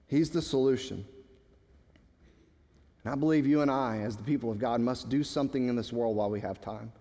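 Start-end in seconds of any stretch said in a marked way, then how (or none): none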